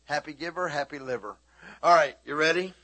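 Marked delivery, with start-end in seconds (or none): 1.8-2.7 loud rallying call. Real, not acted. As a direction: rouse the room. Calling out to congregation.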